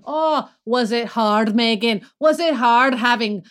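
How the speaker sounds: mock sympathy in Scottish, building to a loud high jab; Scottish husband sneering. Wife imitating her husband heckling Meghan, sarcastic Scottish accent. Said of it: "He's like".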